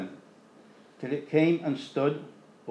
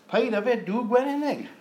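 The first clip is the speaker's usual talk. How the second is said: shouted warning; 8 semitones higher, 3 dB louder than usual. Acted. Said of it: "He shouted out".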